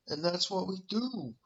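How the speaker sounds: lightly mocking impression of a slow-talking fighter; mock slow talker. Mimicking a fighter who talks like a slowy, mock slow talker. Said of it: "talk like a slowy".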